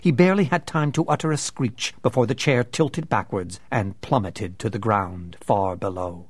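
ordinary delivery

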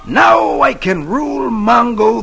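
high, slow gloating tyrant; gloating villain. Acted. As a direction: savour the power, gloating. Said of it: "Zinn gloats".

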